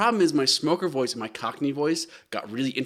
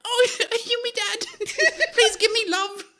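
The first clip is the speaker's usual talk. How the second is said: put-on very high laughing kid voice; scamp kid. Scamp begging stranger for love, high laughing squeak.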